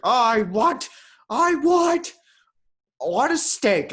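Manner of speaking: put-on high, loud drunken wailing with long pauses; piss drunk. Drunk keeper suddenly demanding steak, mock drunken wail. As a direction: bellow it like a hammed-up drunk. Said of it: "he's piss drunk".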